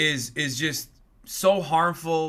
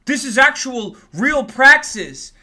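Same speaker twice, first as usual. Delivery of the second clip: high, loud mock gush; sneering impression of an awestruck follower, higher and louder; mocking gushing fan; 5 semitones higher, 7 dB louder than usual. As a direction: ham up a starry-eyed fan, slowing on oh my god. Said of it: "their dumb fucking perverted fans watch it and go".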